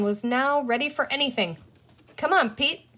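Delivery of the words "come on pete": higher, lightly called summons; calling out. A narrator's reading. Girl calls her brother to come along, lightly calling. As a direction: call a little brother along. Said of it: "Penny called".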